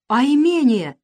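surprised objection. A narrator's reading. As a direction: break in with astonishment. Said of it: "удивлённо перебила его мать".

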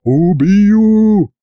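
roared threat. A narrator's reading. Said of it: "Баян заревел". (протяжный угрожающий рёв)

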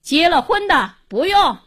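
brusque, emphatic dismissal; curt parting remark. A narrator's reading. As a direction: toss out a final ruling. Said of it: "扔下一句话".

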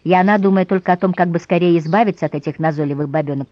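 ordinary delivery, nothing marked

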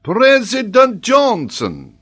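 high, loud, drawn-out exclamation; incredulous professor crying out; astonished cry. Acted. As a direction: cry it out slowly in disbelief. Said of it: "cried Paganel".

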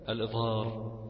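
ordinary delivery

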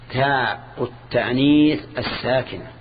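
"تاء التانيث الساكنة" drawn out slowly with breaks between words; deliberate, segmented dictation of "تاء التانيث الساكنة". Shown as a demonstration. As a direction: spell out the term "تاء التانيث الساكنة" one word at a time. Repeats term word by word for analysis.

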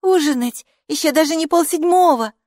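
high, loud astonished question; surprised. A narrator's reading. Хилла reacts to early dinner, high loud surprise. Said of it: "удивилась Хилла".

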